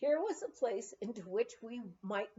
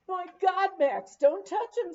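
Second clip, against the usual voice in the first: higher, louder urgent cry of alarm; alarmed warning; 8 semitones higher, 10 dB louder than usual. Acted. Old miner warning Max off the dynamite, alarmed and loud. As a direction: stop him before he touches it.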